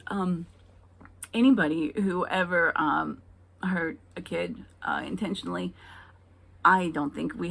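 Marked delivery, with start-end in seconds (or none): none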